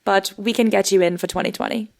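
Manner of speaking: put-on posh British agent accent. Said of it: "your agent just you in a British accent".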